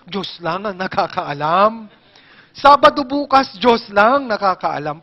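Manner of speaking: slow, put-on sing-song mockery; a drawn-out sarcastic imitation; mocking parody. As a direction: ridicule the empty answer by overacting it. Mocking a silly evasive answer.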